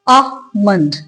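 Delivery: आमंड in two beats, aa-mund; correct pronunciation. Shown as a demonstration. Teacher breaking down the correct word, silent L. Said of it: "correct pronunciation है वो क्या होती है".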